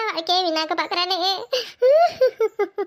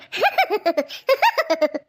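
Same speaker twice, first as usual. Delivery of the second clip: forced laugh. Acted. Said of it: "வேணாண்டி தாயி, நீ சிரிக்கவே வேணாம்".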